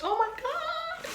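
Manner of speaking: very high, slow, delighted gasp of words; excited squeal. Real, not acted. Vlogger opening her merch, squealing with delight. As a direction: squeal it like you can't believe it.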